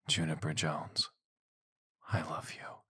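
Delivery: soft, tender confession. Acted. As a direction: say it gently, close.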